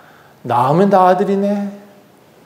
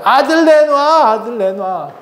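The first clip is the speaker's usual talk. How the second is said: high, stretched-out wail, then dropping; hysterical demand; 7 semitones higher, slower than usual. Acted. Rachel shakes her husband for a son, hysterical demand. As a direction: wail the demand, hammed-up and slow. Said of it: "붙잡고 흔들어대는 거예요".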